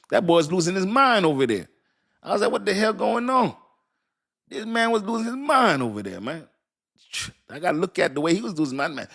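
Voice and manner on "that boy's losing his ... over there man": excited, high retelling. Real, not acted.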